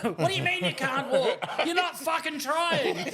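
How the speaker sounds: high, hammed-up indignant yell; mock angry berating. Berating someone who can't walk, mock angry. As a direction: scold loudly in pretend outrage, drawn out at the end.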